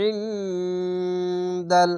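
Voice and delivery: ইনদাল drawn out very slowly in a chant; tajweed-style ইনদাল held out; elongated Quranic recitation. Shown as a demonstration. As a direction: chant ইনদাল stretched long. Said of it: "আইনটিকে মোটা করে গলা চিপে পড়বেন".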